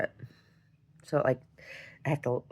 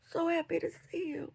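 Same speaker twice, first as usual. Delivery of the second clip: overjoyed; 6 dB quieter than usual. Retold or imitated. Re-enacts tight dream hug.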